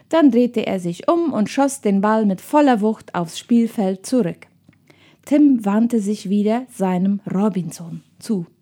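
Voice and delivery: ordinary delivery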